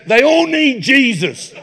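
slow, high mock-pious voice; drawn-out imitation of the friend's pious line; mimicking friend. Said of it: "He goes".

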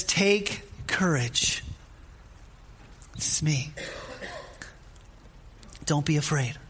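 slow, softened comfort with long pauses; steady the fearful, unhurried and hushed; gentle, drawn-out encouragement; calm reassurance. Acted. Jesus reassures, against imagined exasperation.

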